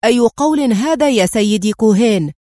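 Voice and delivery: loud, indignant outburst; exclaiming. Acted. General protesting to Cohen, loud exclamation. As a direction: burst out jumping up from the chair. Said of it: "هب من مقعده هاتفا".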